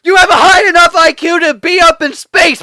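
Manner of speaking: high, loud frustrated outburst; mock choking a character in frustration; mock strangling yell. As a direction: shout it like you're throttling her. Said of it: "be like".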